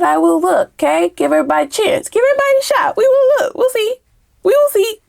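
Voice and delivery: very high, sing-song forced optimism; mock-hopeful sing-song. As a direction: chirp it up high like you barely believe it. Forced optimism about the person.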